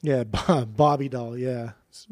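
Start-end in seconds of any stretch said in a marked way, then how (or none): none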